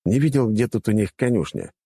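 plain reading voice

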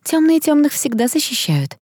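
high-pitched, needling accusation; unpleasant voice. A narrator's reading. Seller accuses Tom of siding with dark ones, unpleasant. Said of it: "неприятным голосом спросил продавец".

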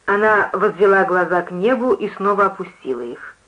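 plain reading voice

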